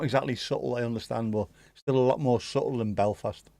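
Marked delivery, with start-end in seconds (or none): none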